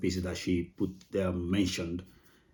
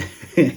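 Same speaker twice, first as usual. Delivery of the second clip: a quick real laugh; short laugh; 6 semitones higher, 11 dB louder than usual. Real, not acted. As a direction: laugh it off, amused. Laughs at being recognized.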